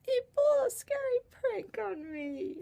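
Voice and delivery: high, loud mock wail; almost in tears. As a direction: whine it out like a hammed-up tearful tattletale. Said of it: "And I'm almost in tears".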